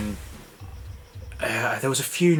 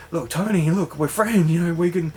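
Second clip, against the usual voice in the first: mock pleading; 6 semitones higher than usual. [name] begging Tony not to hurt him, mock panic. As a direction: plead for your life, hammed up.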